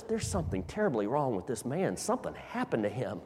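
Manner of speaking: soft, fast, worried aside; hushed concern. Acted. Doctor quietly tells another doctor the man needs help, hushed. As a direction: lean in and murmur it to a colleague.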